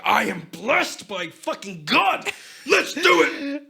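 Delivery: slow proclamation building to high, loud yell; hammed-up holy battle cry; mock crusader war cry. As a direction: proclaim it, then scream the charge. Acts crusader charging into battle.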